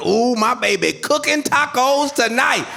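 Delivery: high, loud giddy ooh; excited husband. Retold or imitated. Husband bragging to the crowd about dinner, giddy. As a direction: brag about dinner like a kid. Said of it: "get so excited".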